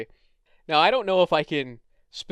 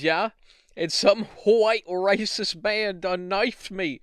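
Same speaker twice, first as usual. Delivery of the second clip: hammed-up bad British street accent; 5 semitones higher than usual. Knifed caller asks for the coppers, bad British accent. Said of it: "my British impression is not good".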